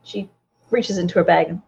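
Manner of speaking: normal talking voice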